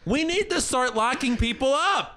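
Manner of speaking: high-pitched mock outrage; exasperated outburst. Real, not acted. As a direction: declare it in a high, ranting voice. Climax of comic rant.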